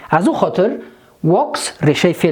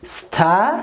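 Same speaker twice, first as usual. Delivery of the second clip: STUDIES stretched out in two separated parts; drawn-out dictation. Shown as a demonstration. Teacher writing out the word for students, drawn-out dictation. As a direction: draw out STUDIES, pausing mid-word.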